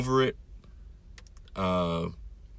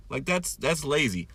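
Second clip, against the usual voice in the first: ordinary delivery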